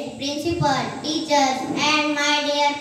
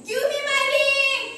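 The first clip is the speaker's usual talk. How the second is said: high, quick ghostly demand; chill voice; 8 semitones higher, faster than usual. Acted. Skeleton demanding her stolen ring back, chill high cry. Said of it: "her chill voice cried".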